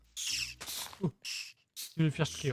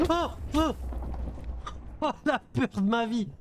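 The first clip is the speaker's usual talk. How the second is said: startled high yelps of fright; screaming in fear; 8 semitones higher than usual. Real, not acted. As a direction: jump scare, cry out in fear. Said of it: "j'ai la peur de ma vie".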